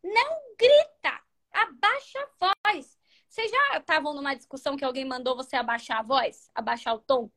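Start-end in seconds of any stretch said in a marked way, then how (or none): 0.0-3.0 high, slow commanding "Não grita, abaixa a voz"; bossy order. Shown as a demonstration. Correcting a partner mid-argument, high slow scolding order. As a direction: snap it as a stern order. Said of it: "não adianta você falar assim".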